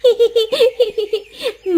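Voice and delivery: a bright giggle at her mother; teasing laugh. Acted. Daughter teasing mother after scolding, giggling.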